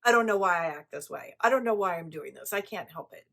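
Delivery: loud, fast, mock-helpless whining; whiny excuse-maker. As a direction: parody someone dodging blame. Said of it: "people can't just say".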